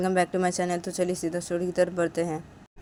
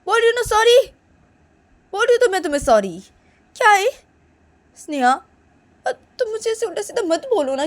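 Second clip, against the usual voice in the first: high, loud whiny begging; shrill hurt girl's plea; high pleading protest; 10 semitones higher, 7 dB louder than usual. Acted. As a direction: beg her to stop, voice way up. Neha begs Sneha to stop slapping.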